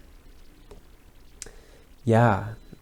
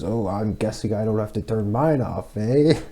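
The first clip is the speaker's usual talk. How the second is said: put-on sly old man, rising cheekily on the eh; cheeky; 3 semitones higher than usual. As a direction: tease the neighbour with a knowing smile. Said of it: "he cheekily goes".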